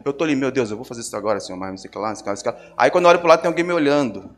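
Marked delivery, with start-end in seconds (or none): 0.3-2.7 low, soft murmur to God; imitated private prayer. Preacher re-enacting his talk with God on the motorbike, low murmured prayer. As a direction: pray under your breath, trailing into filler.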